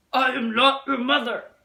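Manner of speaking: loud, stern grumpy bear. Acted. Bear telling the goslings off, loud and stern. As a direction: bark it at the goslings like a cross bear. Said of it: "Bruce was very stern".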